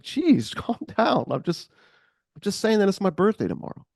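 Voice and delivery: high, defensive mock whine. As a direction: back off, wounded and high. Defensive reply in bar quarrel.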